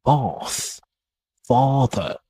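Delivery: "bath" and "father" with a long open British "ah" vowel. Shown as a demonstration. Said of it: "British also tend to have a longer vowel sound".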